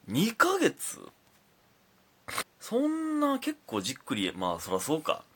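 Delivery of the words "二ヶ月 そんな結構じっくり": high, stretched disbelief; can't believe it took two months; real surprise, voice jumping up; surprised. Real, not acted. Reacting to listener's letter.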